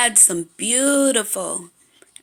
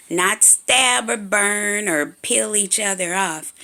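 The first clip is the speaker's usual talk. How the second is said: usual speaking voice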